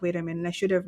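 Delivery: normal talking voice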